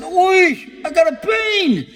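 high-pitched mock yelp, drawn out on "pain"; acted cry of pain. Stricken man to a passerby as his heart fails, acted cry of pain. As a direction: imitate someone crying out in sudden agony.

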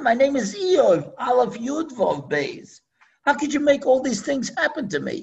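high, pleading cry to God; Job's complaint. Acted. Eov protesting to Hashem, high pleading complaint. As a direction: plead with God, hurt and bewildered.

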